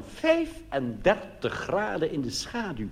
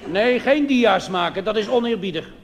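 a hint of a bossy tour guide, higher and louder; lightly voiced tour guide; 7 semitones higher, 4 dB louder than usual. Tour guide telling the pilgrims the rules, lightly bossy. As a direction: lay down the rules to the group, slowly.

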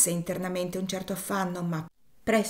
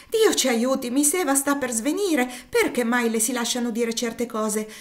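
higher and louder, frightened outcry; alarmed cry; 6 semitones higher, 6 dB louder than usual. Acted. Cook sees Eva fainting.

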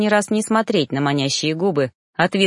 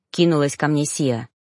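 usual speaking voice

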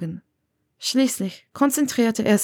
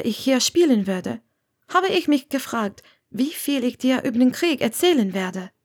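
slightly raised character voice; lightly voiced earnest woman; 7 semitones higher than usual. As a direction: voice a woman carefully explaining herself. Narrator voices Hermione.

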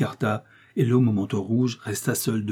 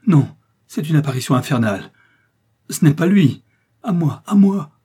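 raw, loud, higher cries for help; hoarse terrified cry; 6 semitones higher, 8 dB louder than usual. Acted. Milady turns to the wall, crying hoarsely for help. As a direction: scream for help in raw panic. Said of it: "s'écria-t-elle d'une voix rauque".

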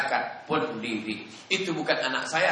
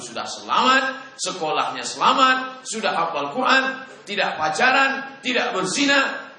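proud mother; 8 semitones higher, 5 dB louder than usual. Retold or imitated. Ibu bangga pada anak.

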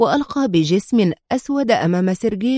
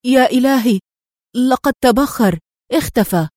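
louder burst of astonishment; astonished; 4 semitones higher, 4 dB louder than usual. A narrator's reading. Man finds the road outside empty, astonished. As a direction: gasp it out in disbelief, not muttered. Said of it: "في دهشة قبل أن يغمغم".

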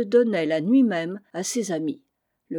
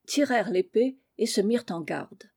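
plain reading voice

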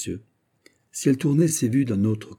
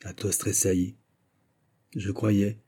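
ordinary delivery, nothing marked

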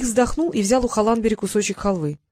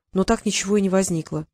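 ordinary delivery, nothing marked

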